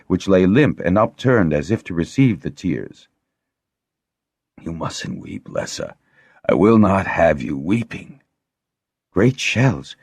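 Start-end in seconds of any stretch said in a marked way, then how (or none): 4.6-8.2 put-on faint, breaking old man's voice; weak failing voice. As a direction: barely get the words out. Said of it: "He was losing his voice control".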